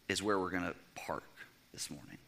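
a hushed whisper to the congregation. Real, not acted. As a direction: lean in and whisper it. Preacher drops to hushed aside.

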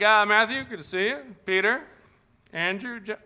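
high, loud cheery roll call; John taking attendance. Retold or imitated. John calls roll, teasing late Thomas, cheerfully. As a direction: greet Thomas with a teasing laugh. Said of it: "He's like".

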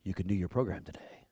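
lightly voiced hypnotized echo; soft, low, obedient mimicry; mind-tricked cop. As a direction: repeat it back low and quick, as if entranced. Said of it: "a Jedi mind trick on the guy".

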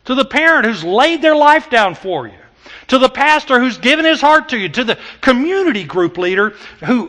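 loud, high, impassioned preaching; full-force preaching. Acted.

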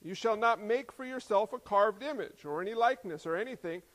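loud, emphatic commandment reading; forceful, measured Bible reading; scripture proclamation. Acted. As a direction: lay down the law from the pulpit. Reads commandments aloud.

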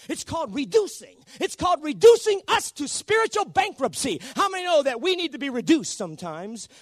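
high, loud revival preacher; fervent preaching. Real, not acted. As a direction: drive it at the congregation, ringing and insistent. Preaches at full force.

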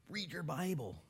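usual speaking voice

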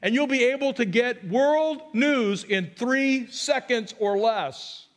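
hammed-up marvelling at technology; mock God announcing. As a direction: play a mock-excited prophecy of the future. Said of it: "he didn't say".

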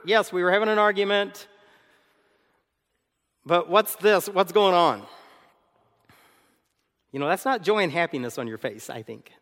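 normal talking voice

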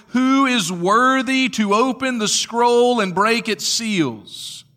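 high, loud angelic herald; loud proclamation. Acted. Strong angel asking who can open the scroll, loud proclamation. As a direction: ring it out as a challenge to all. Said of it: "proclaiming with a loud voice".

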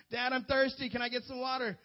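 put-on high little-kid plea for water; child asking dad for water; whiny kid. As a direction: beg like a thirsty six-year-old. Kid pleading again.

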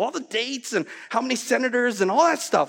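usual speaking voice

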